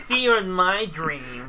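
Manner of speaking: slow, dreamy drawl; slow drawn-out. Retold or imitated. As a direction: stretch it out like a boss. Repeating the quote slowly.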